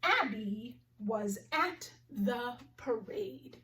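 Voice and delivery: slow, carefully spaced sentence; slow model sentence; slow clear reading. Shown as a demonstration. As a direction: read it slowly for the class. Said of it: "The first sentence I want to practice".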